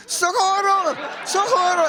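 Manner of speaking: high-pitched public cry for help; calling for help. Retold or imitated. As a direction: call out as if in an emergency. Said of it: "something you say in a public situation".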